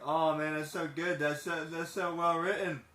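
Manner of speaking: high, loud, excited admiration; gushing praise. Retold or imitated. Praising the movie's writing, high and excited. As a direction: rave about the writing. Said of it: "And I'm like".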